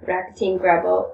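plain reading voice

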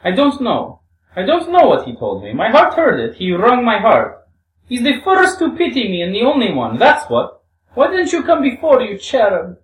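high, loud, impassioned outpouring; Grushenka, fervent. Acted. Reads Grushenka's fervent answer.

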